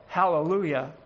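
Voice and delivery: usual speaking voice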